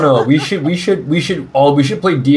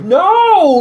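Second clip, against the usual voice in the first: high pleading no; 4 dB louder than usual. Acted. Begging Opal to stay.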